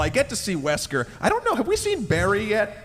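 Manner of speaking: ordinary talk, nothing marked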